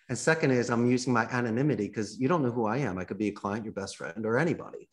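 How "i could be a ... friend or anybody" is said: ordinary delivery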